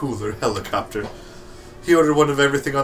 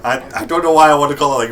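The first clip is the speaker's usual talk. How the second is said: ordinary talk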